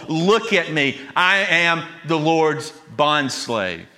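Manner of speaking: "look" and "I" stressed, the bondslave line drawn out slowly; emphatic declaration. Retold or imitated. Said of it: "it's very emphatic".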